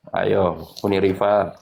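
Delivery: ordinary talk, nothing marked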